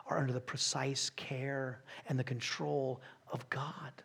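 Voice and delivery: hushed awed whisper. Acted. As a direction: breathe it out reverently. Awed reflection.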